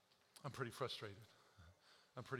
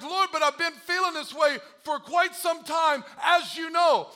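pleading protest; 11 semitones higher, 12 dB louder than usual. Retold or imitated. Complaining to God in story.

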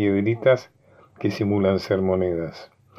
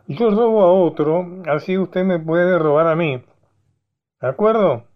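higher, louder eager proposal; excited clown; 9 semitones higher, 4 dB louder than usual. Acted. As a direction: pitch the plan like a cheeky clown. (bufón entusiasmado, más agudo y fuerte)

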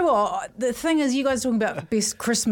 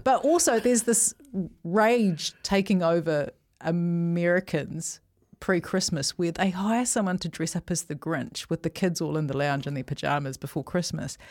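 usual speaking voice

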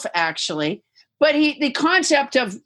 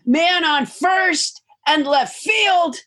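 bellowing announcer call; 5 semitones higher, much slower than usual. Retold or imitated. Imitating the play-by-play guy with a big slow shout. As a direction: boom it out like a stadium announcer. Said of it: "So I would say".

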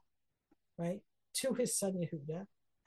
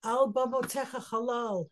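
loud, higher, ringing recitation of the verse; declaimed lament; 5 semitones higher, 7 dB louder than usual. Real, not acted. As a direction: proclaim al bamotecha chalal like a dirge. Recites lament line again, declaimed.

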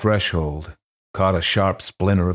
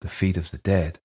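quavering voice. A narrator's reading. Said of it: "The deacon piped up in a quavering voice".

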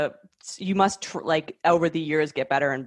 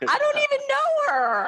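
mock squeaky voice. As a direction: squeak like a nervous liar. Mocking high squeaky voice.

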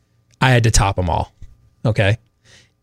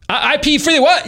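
much higher, puzzled mock question; mock deaf teacher; 13 semitones higher than usual. Half-deaf teacher repeating the prank line, high and puzzled. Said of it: "you just like".

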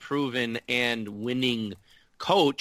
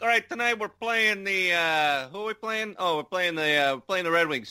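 put-on goofy made-up accent for a pregame pep talk; Gallant's put-on accent; 6 semitones higher than usual. Podcaster voices the coach before a game in a goofy put-on accent. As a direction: ham up an odd accent as the coach rallying his team. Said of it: "I don't know why I gave Gallant this accent".

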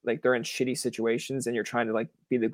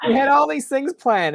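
much higher, louder mock shriek of disbelief; shocked outburst; 13 semitones higher, 7 dB louder than usual. Host imagining his reaction to the guest's vanished plans, shrill mock disbelief. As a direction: squeal it in fake outrage.

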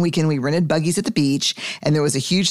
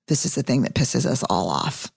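normal talking voice

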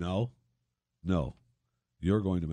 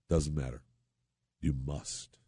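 softer, lower "Doesn't matter", falling; quiet repeat; 5 semitones lower, 8 dB quieter, slower than usual. Real, not acted. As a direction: say "Doesn't matter" low and soft, resigned. Host repeating his point after a pause.

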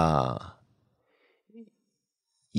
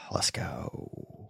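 whispered; 6 semitones lower, 15 dB quieter than usual. Real, not acted. Quiet cue before playing caller.

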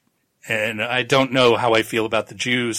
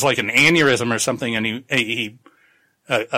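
ordinary talk

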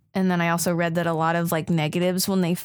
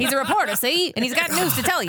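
high, punchy vintage newsman patter; mock old-timey newsreel reporter; 7 semitones higher than usual. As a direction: put on an old-timey newshound. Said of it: "all in that old-timey voice".

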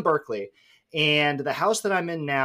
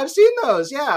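mock crew reply; 12 semitones higher, 8 dB louder than usual. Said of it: "the crew, they're like".

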